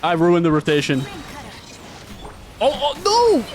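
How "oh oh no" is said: high startled oh no; high anguished oh no; dismayed cry. Real, not acted. Realizes rotation is ruined.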